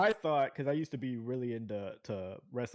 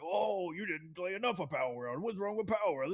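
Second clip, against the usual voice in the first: mock indignant fan; 6 semitones higher than usual. Game fan challenging someone's dislike, mock indignant. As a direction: imitate a fan demanding an explanation.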